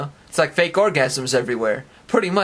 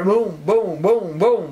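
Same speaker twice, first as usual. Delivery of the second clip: higher-pitched vocal explosion noises; imitated booms; 5 semitones higher, 3 dB louder, much slower than usual. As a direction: boom it out repeatedly like blasts.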